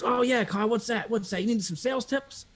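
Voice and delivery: high, eager phone rep voice; eager salesman. Retold or imitated. Sales rep eagerly asking Kyle what he needs, high and pushy. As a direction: lean in like a hungry closer.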